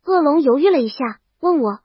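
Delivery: usual speaking voice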